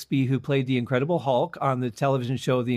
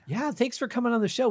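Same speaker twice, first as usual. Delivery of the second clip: higher-pitched, energetic thanks to a guest; put-on excitement; 7 semitones higher than usual. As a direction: lay on a little show of enthusiasm. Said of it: "Do I sound like I'm excited".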